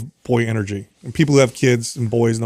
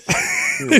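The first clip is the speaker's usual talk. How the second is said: breathless laugh. Real, not acted. Dad laughing at own prank.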